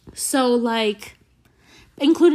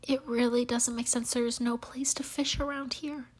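very soft, half-whispered; hushed bafflement; 11 dB quieter than usual. Real, not acted. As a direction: puzzle over it under your breath. Baffled whispered aside continues.

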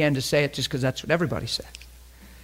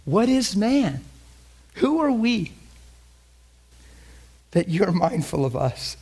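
wondering; 5 semitones higher than usual. Acted. Voices the psalmist's wonder.

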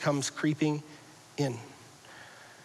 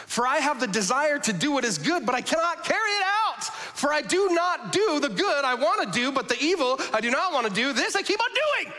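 much higher, straining confession; anguished reading; 9 semitones higher than usual. Acted. Preacher voicing Paul's struggle, high and pleading. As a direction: cry out Paul's frustration.

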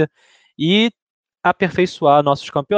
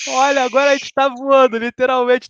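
excited; 9 semitones higher than usual. Acted. Joking about flying in plane.

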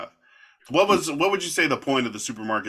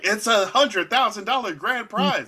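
high-pitched mock excitement; lightly acted game-show hype; hyped prize announcement; 9 semitones higher than usual. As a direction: sell the grand prize, voice lifted. Imitating show tempting with prize.

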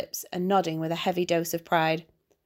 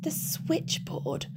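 soft, slow, breathy scoff at the switchboard; sneering under his breath; 9 semitones higher, 8 dB quieter, much slower than usual. Acted. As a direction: mutter it with contempt, drawn out. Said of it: "Crowley scoffed".

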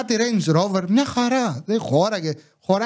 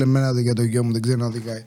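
lower, faster, mock outraged neighbour; indignant neighbour; 8 semitones lower, faster than usual. Imitating the offended neighbour defending his son, lower and faster. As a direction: fire back like the offended father. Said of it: "μου πεις, λέει".

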